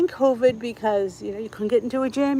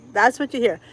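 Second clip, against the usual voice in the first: normal talking voice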